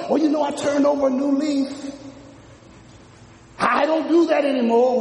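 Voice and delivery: proud not to do it anymore; mock reformed sinner. Preacher voices a reformed person to a friend, smug.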